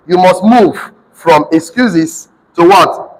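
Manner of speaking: drawn out, waiting for the class; slow prompting question. Shown as a demonstration. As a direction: stretch it so they fill in the answer. Teacher prompting class to answer.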